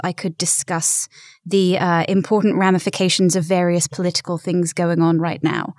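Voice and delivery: a hint of formal British newsreader enunciation. Shown as a demonstration. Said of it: "a little bit more pronounced".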